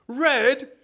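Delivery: high, loud, drawn-out put-on outrage; mock shock. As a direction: protest in a silly high voice, breaking into laughter. Mock outrage at Steph's dress colour.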